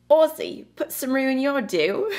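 a clumsy attempt at an Aussie accent, up high; bad Australian accent. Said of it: "put on my best Australian accent".

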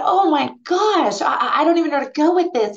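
overwhelmed. Retold or imitated. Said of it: "And I thought".

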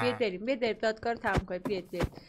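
low, soft, hurried undertone; offhand low murmur to wrap up; hushed aside. Real, not acted. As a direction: quietly usher everyone out.

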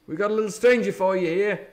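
put-on high, loud, jovial old sea cook; hearty pirate patter; beaming Silver. A narrator's reading. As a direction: chatter on with false cheer. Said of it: "alteration in his voice".

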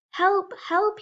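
high, loud cry for help, like a trapped goat; loud bleating cry. Acted. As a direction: yell for help from the bottom of the trap. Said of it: "he bleated as loudly as he could".

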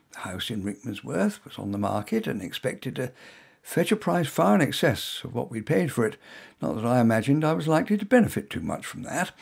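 usual speaking voice

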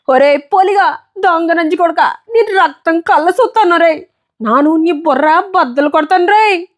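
high, weeping vow of revenge; tearful threat. Acted. Bleeding boy swearing revenge on the bully, tearful and high. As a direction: swear revenge through tears. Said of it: "పిచ్చిగా ఏడుస్తూ".

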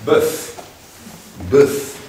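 bœuf said the French way, rounded vowel. Shown as a demonstration. Teacher showing the class the French word bœuf. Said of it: "They say".

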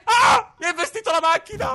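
high outraged shout; emphatic italian speech. Real, not acted. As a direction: yell in shocked outrage. Car run over in game.

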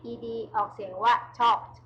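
"talked" ending in a crisp t, slowly; "talked" with one syllable, ending t; ed as t sound. Shown as a demonstration. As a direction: say "talked" slowly, final t clear.

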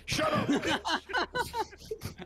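shrill slow falsetto stretch; very high drawn-out squeal. Shown as a demonstration. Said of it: "repeated differently".

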